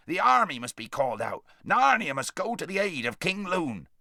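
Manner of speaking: a practical dwarf rousing the others; bustling dwarf. Acted. Dwarf voiced in story.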